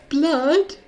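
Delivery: put-on high, loud, shocked boy's voice; flabbergasted boy. Random boy in the crowd, stunned by blood, in a high flabbergasted voice. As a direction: play a startled boy who never expected blood. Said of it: "he has the most flabbergasted reaction".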